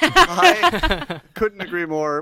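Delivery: slow loud laughing delivery. Real, not acted. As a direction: laugh it out, drawn out.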